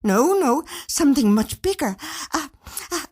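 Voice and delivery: wife gushing in breathless excitement; spurted out. A narrator's reading. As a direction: blurt it out, tripping over the a's. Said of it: "she spurted out".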